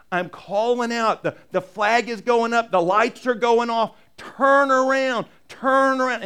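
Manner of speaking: building to a high, loud slow cry; urgent warning call. Acted. God crying out to the Ninevites to turn around, building shout. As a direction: raise the alarm, calling them to turn around.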